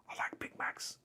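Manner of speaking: soft breathless whisper to himself; whispered aside. Real, not acted. Streamer whispering his love of Big Macs to viewers, whispered. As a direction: whisper it like a little secret craving. Aside while reloading the game.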